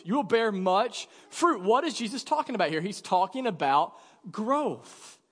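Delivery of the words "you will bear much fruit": usual speaking voice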